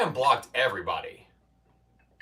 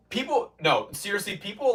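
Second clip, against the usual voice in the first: ordinary talk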